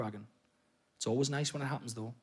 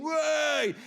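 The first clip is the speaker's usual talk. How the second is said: mock lads' cheer; 7 semitones higher than usual. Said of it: "mates behind him going".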